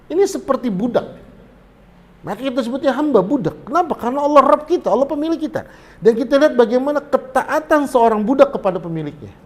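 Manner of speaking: high, loud fervent preaching; impassioned sermon climax; preaching at full force. Acted. Rising sermon intensity.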